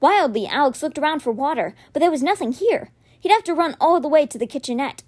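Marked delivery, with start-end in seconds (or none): none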